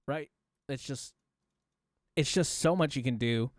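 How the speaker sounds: ordinary talk, nothing marked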